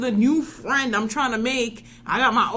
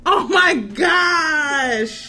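mock wail; 5 semitones higher, 3 dB louder, much slower than usual. Mock despair over old topic.

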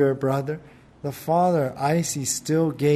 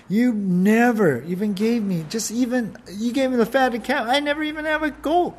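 1 accusing whine; 6 semitones higher than usual. Acted. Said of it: "Accusing the father wrong".

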